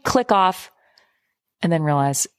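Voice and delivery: normal talking voice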